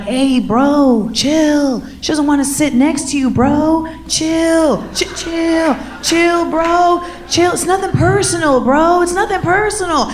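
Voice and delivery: high laid-back bro voice; frat bro. Retold or imitated. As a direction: soothe him like a chill frat bro. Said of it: "They're like".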